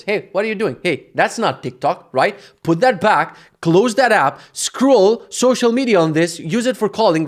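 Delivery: loud, high warning to hand the phone back; sudden scolding. Real, not acted. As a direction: firmly stop someone from taking the phone. Suddenly scolding someone nearby.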